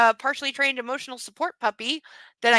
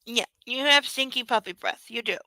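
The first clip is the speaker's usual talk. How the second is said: normal talking voice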